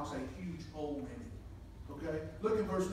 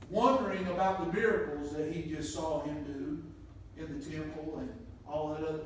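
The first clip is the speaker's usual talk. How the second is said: normal talking voice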